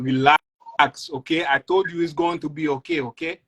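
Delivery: high, defensive Haitian robber; Haitian accent. Acted. Haitian robber answering his angry partner in a Haitian accent. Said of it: "a Jamaican and a Haitian".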